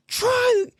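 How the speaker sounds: very high mock-angry squawk; squeaky imitation of a fed-up customer; indignant woman. Line cutter closing her door, mock-indignant woman. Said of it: "she goes".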